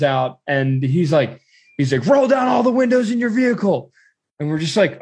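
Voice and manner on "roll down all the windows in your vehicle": high, hard mock guard shout; shouted order. Guard orders travelers at the crossing, shouted imitation. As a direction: bark it like an armed border guard.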